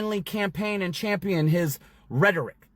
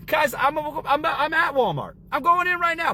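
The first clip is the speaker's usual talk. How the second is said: excited to tell followers he's going into Walmart; 10 semitones higher than usual. Real, not acted. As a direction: burst in like you can't wait to show them. Arriving at Walmart to film.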